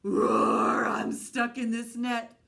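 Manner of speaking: great big roar. Acted. Trapped lion roars for help from the net, loud. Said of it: "He let out a great big roar".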